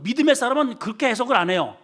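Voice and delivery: usual speaking voice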